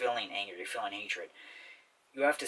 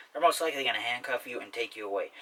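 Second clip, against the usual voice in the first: ordinary talk, nothing marked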